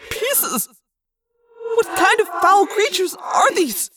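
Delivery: high, loud, slow terrified voice; phobic panic. Acted. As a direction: speak in shuddering horror. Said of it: "He sounded terrified".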